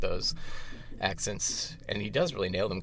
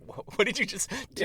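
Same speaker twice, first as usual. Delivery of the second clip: high, startled what did you do; stunned director; 10 semitones higher than usual. Retold or imitated. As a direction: sound caught off guard. Director reacting in rehearsal.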